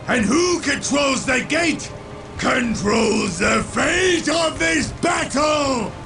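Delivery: rallying battle cry, building to the end; defiant shout. Acted. Commander answering an orc's taunt, defiant shout. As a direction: drive each phrase home forcefully.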